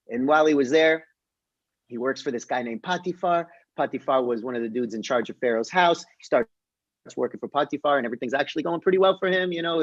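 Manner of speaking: normal talking voice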